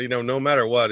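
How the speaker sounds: ordinary talk, nothing marked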